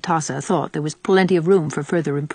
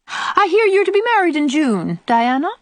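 high, loud, pointed gossip; pry with a high, knowing voice; prying old aunt; 11 semitones higher, 5 dB louder than usual. Acted. Old aunt raising Diana's rumoured wedding, prying.

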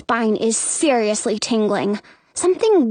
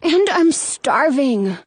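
put-on slow, higher little-kid whine; whining; 4 semitones higher, slower than usual. A narrator's reading. Hungry brother begging, whining. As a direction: drag out the complaint. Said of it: "he whines".

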